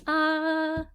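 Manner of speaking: a high sigh of wonder; awed ah. Retold or imitated. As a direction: breathe out in awe. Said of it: "like I said, we got this".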